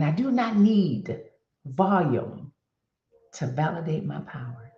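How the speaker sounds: measured and weighty, with pauses before VOLUME and "to validate"; deliberate repeated affirmation. Real, not acted. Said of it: "Let me say that again".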